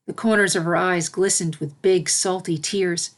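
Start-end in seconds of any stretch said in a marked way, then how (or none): none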